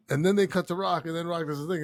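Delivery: ordinary talk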